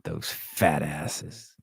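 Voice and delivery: slow, drawn-out echo of the clip's line; savoring the quote. Retold or imitated. Streamer repeating a line he loves from the clip, slow and savoring. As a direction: relish each word like a favorite movie line, slowly. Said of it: "God, what a fucking great line".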